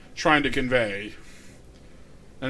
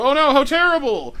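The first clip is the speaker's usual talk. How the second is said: higher, louder hammed-up gasp of horror; mock dismay; 10 semitones higher, 7 dB louder than usual. Streamer retelling a proverb to viewers, hammed-up dismay. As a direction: play the shocked villagers, half joking. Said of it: "and they go".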